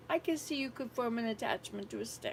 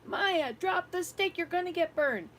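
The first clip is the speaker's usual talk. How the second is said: higher and louder as it builds, slow on the third; retold yelling; 7 semitones higher, 5 dB louder, slower than usual. Grandmother retelling yelling at the girl by the fire, building yell. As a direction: yell at the child more insistently each time. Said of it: "I'm yelling at her".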